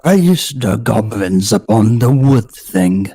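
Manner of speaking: put-on "Praise" said loud and clear in a slow polar bear accent. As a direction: boom the chant so every word comes through. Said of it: "The first word is getting lost".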